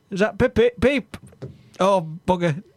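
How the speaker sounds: usual speaking voice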